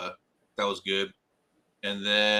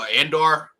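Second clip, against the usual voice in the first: normal talking voice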